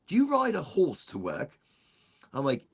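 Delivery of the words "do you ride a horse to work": curious British cop. Retold or imitated. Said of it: "He goes".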